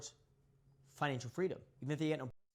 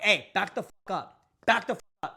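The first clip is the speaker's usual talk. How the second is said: louder, firmer back-off command; firm warning; 6 semitones higher, 12 dB louder than usual. Retold or imitated. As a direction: shut him down firmly. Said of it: "I'm just, like, calm".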